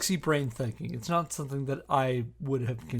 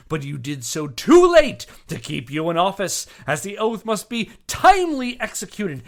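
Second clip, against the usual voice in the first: louder, declaiming each charge like a prosecutor; dramatic letter reading; 4 semitones higher, 8 dB louder than usual. Acted. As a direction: read it out as a righteous indictment. Reads the accuser's letter aloud.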